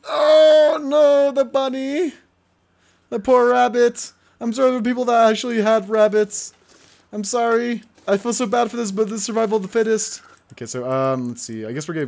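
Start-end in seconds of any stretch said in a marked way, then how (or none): 0.1-2.1 high, loud wailing cry; anguished cry. Real, not acted. Gamer as the bunny dies, a loud anguished wail. 3.1-10.2 sorry for the rabbit, overdone; mock grief. Real, not acted. Gamer apologising for the dead rabbit, higher and sorrowful.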